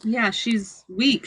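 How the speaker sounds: ordinary delivery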